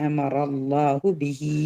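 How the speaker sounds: slow chant with അമറ held four counts; four count stretch. Shown as a demonstration. Teacher showing students the four-count madd, slow chant. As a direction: hold the long vowel a full four beats. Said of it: "ഇവിടെ four count".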